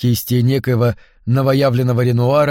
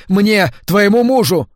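high, slow, emphatic cry; breaking into a shout; 7 semitones higher, 4 dB louder than usual. A narrator's reading. Husband insists on his rights to his wife, nearly shouting. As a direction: raise the voice high and stretch each word. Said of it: "сбиваясь на крик".

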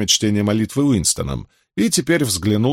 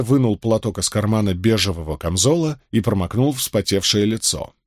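plain reading voice

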